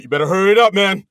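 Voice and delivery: urgent warning. Retold or imitated. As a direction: imitate an impatient lookout. Urgent warning quoted to Marty.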